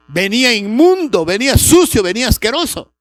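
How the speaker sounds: preaching fervour. Real, not acted. Preaching climax on the son.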